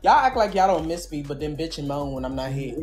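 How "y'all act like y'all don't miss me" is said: ordinary talk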